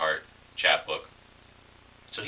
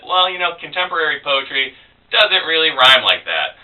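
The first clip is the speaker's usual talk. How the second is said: lightly voiced teacher, pitched up; a hint of a teacher gently correcting; imitated teacher; 7 semitones higher than usual. Teacher telling a student poetry doesn't rhyme, lightly imitated. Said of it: "except she said".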